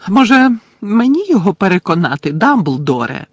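high, loud witch's offer; called out. A narrator's reading. Portrait witch offering to persuade Phineas, high loud call. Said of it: "гукнула гостроока чаклунка".

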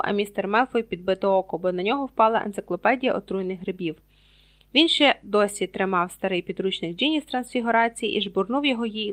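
ordinary delivery

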